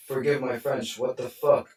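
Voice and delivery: ordinary talk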